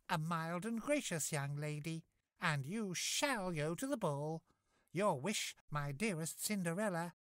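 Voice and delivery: high, kindly fairy voice; sweet magical godmother. Acted.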